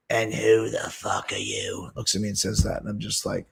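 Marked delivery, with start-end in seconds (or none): 0.1-1.9 slow, softer contemptuous challenge; Sharon Osbourne, pissed. Retold or imitated. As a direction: imitate an angry woman turning on a stranger. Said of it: "she goes".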